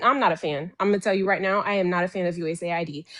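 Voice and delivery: ordinary delivery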